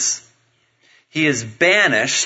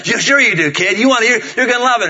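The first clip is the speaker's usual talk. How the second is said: higher-pitched, hurried persuasion; coaxing dad; 7 semitones higher, 3 dB louder than usual. Retold or imitated. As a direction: talk the kid round quickly. Dad coaxing the protesting child.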